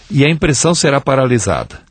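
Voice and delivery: normal talking voice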